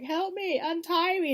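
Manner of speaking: high, pleading yelp; hammed-up desperate plea; mock pleading cry. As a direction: beg to be untied in mock panic. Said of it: "Tyrion's like".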